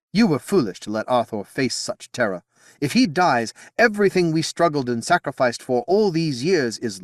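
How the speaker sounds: loud, rising reproach; scolding queen. Acted. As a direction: berate him for risking her son.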